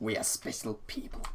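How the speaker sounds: soft breathy whisper; whispered aside. Acted. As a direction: whisper it to yourself. Whispered follow-up.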